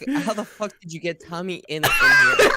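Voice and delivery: laughing, then shouting the last words high and loud; shocked disbelief. Retold or imitated. Friend retelling his shock at seeing a lookalike, laughing yell. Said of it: "I was like".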